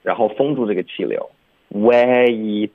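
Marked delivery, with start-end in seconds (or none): 1.7-2.7 "way" drawn out, then the airflow cut for an unreleased t; stopped final T. Shown as a demonstration. Teacher showing the dropped T, "way" ending in a stop. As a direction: seal off the end of "way" without releasing t. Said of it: "就导致一个停顿".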